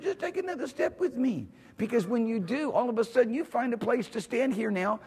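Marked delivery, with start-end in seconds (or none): 0.0-1.5 high, light pleading invitation; high-pitched gentle urging; the Lord. Acted. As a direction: coax them along in a high, soft lilt. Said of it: "the Lord said".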